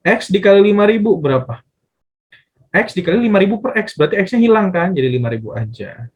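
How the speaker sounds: ordinary talk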